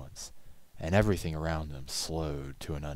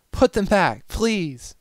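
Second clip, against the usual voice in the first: high, loud desperate plea; begging; 11 dB louder, slower than usual. A narrator's reading. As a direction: beg in panic. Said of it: "she begged".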